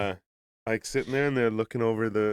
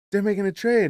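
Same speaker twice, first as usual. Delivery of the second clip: urgent alarm; 9 semitones higher, 4 dB louder than usual. Retold or imitated. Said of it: "He's like".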